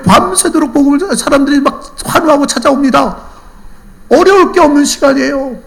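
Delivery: high, loud sermon delivery; impassioned preaching. Real, not acted. Preacher admonishes congregation about not praying, impassioned. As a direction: drive the lesson home at full force.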